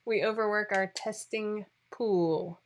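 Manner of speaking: each word drawn out slowly; slow deliberate. Shown as a demonstration. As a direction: say it really slowly for clarity. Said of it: "I'm going to say that really slowly".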